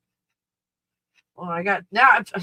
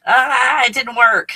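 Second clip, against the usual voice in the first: louder, exasperated; 5 semitones higher, 8 dB louder than usual. Real, not acted. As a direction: throw up your hands. Loud exasperated.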